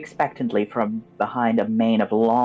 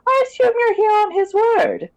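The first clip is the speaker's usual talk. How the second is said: high, cackling crone voice; smug old hag, pleased with herself; smug witch voice; 4 dB louder than usual. Acted. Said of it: "she says with a smug air of confidence".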